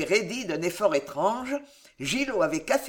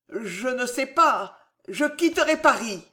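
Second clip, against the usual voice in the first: put-on nervous young man; 5 semitones higher, 3 dB louder than usual. A narrator's reading. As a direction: answer timidly, pitched up. Nephew answers fearfully.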